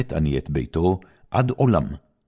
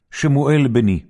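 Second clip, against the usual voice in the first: high, loud call of the name; calling the boy; 9 semitones higher, 5 dB louder than usual. Acted. Eli calling Samuel in the morning, high and loud. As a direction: summon him firmly.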